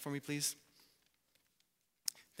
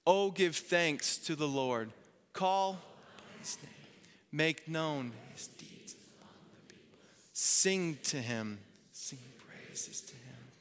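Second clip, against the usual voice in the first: congregational reading; 3 dB quieter, slower than usual. Real, not acted. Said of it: "Let's say these words together".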